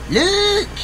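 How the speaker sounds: excited high exclaim. Acted. Excited about thumb.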